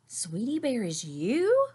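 slow rising disbelief; surprised question. A narrator's reading. Brother discovering the goalie is his admirer, slow disbelief. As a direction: ask slowly, can't believe it.

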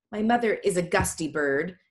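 usual speaking voice

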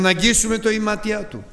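the sick crowd pleads to touch him; eager crowd. Retold or imitated. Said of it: "Και έλεγαν". (λαχτάρα του πλήθους)

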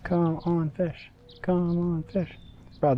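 normal talking voice

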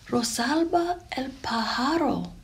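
breathy whispered Spanish words. A narrator's reading. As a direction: whisper the name like a wonder. Grandmother's imagined words.

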